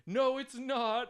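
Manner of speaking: high, drawn-out contradiction; mock a contrary audience member, slow and high; stretched-out heckler retort; imitated heckler.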